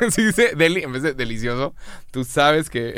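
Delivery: talking through real laughter; laughing. Real, not acted. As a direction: crack up while explaining the joke. Cracking up at own joke.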